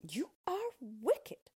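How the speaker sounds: high, slow, indignant cry; crying out angrily. Acted. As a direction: cry it out in outrage. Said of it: "cried Dorothy".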